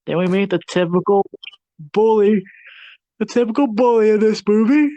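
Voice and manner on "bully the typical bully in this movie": mock bully voice. Describing bully.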